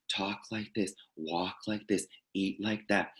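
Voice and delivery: hushed, soft imitation of forced seriousness; a hushed mock of the solemn teacher; mock serious monk voice. Mocking solemn teachers with a hushed, soft imitation. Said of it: "this, like, very forced seriousness".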